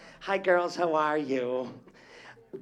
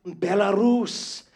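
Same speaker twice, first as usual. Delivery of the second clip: drawn-out amazed echo; 4 semitones higher, 8 dB louder, much slower than usual. Real, not acted. Echoing audience member's hometown.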